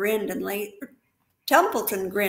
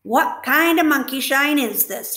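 mild growl; 5 semitones higher, 7 dB louder than usual. Acted. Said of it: "he growled".

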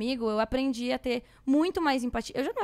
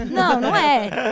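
emphatic no; 10 dB louder, slower than usual. Real, not acted. Denying she is like Jesus.

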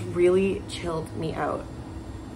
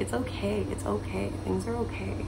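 softer, gentle reassurance to her future self; quiet comforting murmur; 2 semitones higher, 7 dB quieter than usual. Real, not acted. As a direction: soothe yourself quietly that it's okay. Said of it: "I wanted to tell myself about that".

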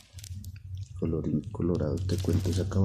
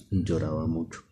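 ordinary delivery, nothing marked

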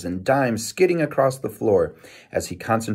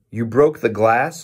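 mildly angry questioning; a touch of irritated father; ticked off; 2 semitones higher, 4 dB louder than usual. A narrator's reading. Father confronting son over broken window, mildly ticked off. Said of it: "Their father sounded ticked off".

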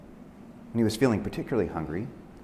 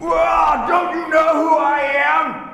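high, very loud, drawn-out roar; wolf's roar; 9 dB louder, much slower than usual. Acted. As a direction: bellow it as an outraged wolf. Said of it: "He roared".

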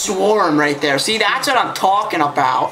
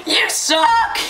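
laughing taunt; 12 semitones higher than usual. Real, not acted.

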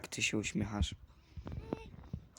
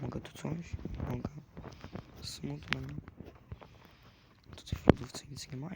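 usual speaking voice

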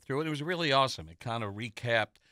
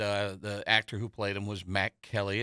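ordinary delivery

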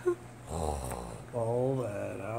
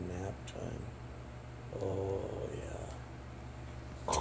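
soft hushed whisper; 3 semitones lower, 8 dB quieter, much slower than usual. Real, not acted. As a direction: breathe the words to a sleepy pet. Whispering to sleepy dog.

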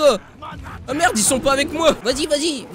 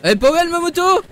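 higher, louder and fast, showing off; proud excitement; 6 semitones higher, 5 dB louder, faster than usual. Real, not acted. Streamer showing his motorbike to friends, excited bragging. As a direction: brag about the bike eagerly.

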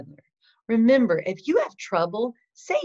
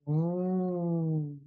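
W sound demo; 4 dB quieter than usual. Shown as a demonstration. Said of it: "the sound for the letter W".

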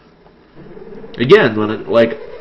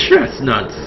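mock curse exclamation; 3 semitones higher than usual. Shown as a demonstration. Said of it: "I uttered such choice things as chestnuts".

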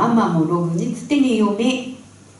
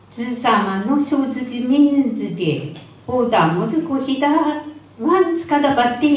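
put-on higher, coaxing old neighbour woman; old granny voice; 7 semitones higher than usual. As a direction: offer it like a chatty village granny. Neighbour granny offers food. (高めの声の近所のばあさま)

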